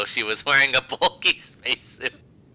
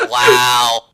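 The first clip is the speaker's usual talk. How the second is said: loud laughing cry; 11 dB louder than usual. Real, not acted. Cracking up at the silly spell name, whooping laugh.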